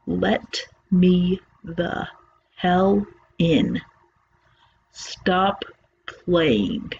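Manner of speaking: lightly voiced inhuman thing, halting and very slow; halting, weird creature voice. A hint of a creature at the door, halting and slow. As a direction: drag each word out like something faking speech. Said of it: "It says in this halting, weirdly toned voice".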